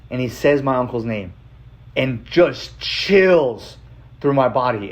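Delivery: ordinary delivery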